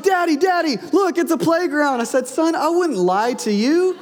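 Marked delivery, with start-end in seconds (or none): 0.0-2.0 put-on high, thrilled little boy calling out; excited child. Boy calling his dad at the playground, high and thrilled. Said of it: "He's like". 2.3-4.0 laughing. Retold or imitated. Dad jokes about his lie.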